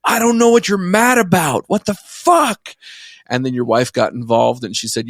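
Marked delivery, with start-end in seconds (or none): 0.0-2.7 high-pitched mock scream, ending on a drawn-out word; retold screaming. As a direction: act out screaming back in a fight, voice shooting up high. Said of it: "I'm, like, screaming at you".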